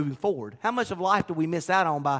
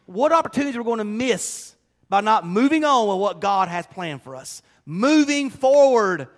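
preaching louder and higher, slowing on the key words; rising preaching; 6 semitones higher, 6 dB louder than usual. Acted. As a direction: preach it with growing force toward move on. Preacher building to climax.

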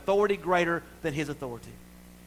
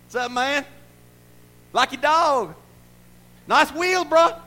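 high, loud hollered hellos; cocky greeting; 13 semitones higher, 11 dB louder, slower than usual. Acted. Bodyguarded man hailing strangers, cocky shout. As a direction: call out breezily across the street.

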